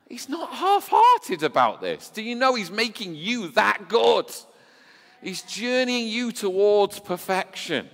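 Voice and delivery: soaring high and loud, preaching hard; full-force preaching. Real, not acted. Preacher urging the congregation, high and loud. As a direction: lift it up and drive it home to the church.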